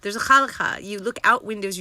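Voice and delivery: usual speaking voice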